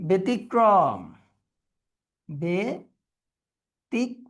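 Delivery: "ব্যতিক্রম" said slowly, then split "be... tik... krom"; syllable-by-syllable pronunciation. Shown as a demonstration. Teacher showing students ব্যতিক্রম, slowly syllable by syllable. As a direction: break "ব্যতিক্রম" into spaced syllables. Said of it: "সহজ সহজ উচ্চারণগুলোই".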